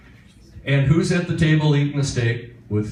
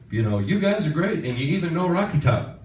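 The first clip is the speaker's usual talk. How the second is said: lightly imitated singer, pitched higher; 6 semitones higher than usual. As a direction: slip lightly into his higher voice. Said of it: "he said".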